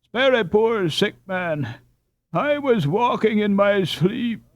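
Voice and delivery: high, slow whining plea; pleading robber. A narrator's reading. Captured robber begging the boys, whining plea. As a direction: beg for mercy pitifully.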